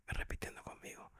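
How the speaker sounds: hushed whisper, almost no voice. Real, not acted. Doctor recalling a dying patient's prayer, whispered. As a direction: breathe it out softly, as if at a deathbed. Tender deathbed moment, whispered aside.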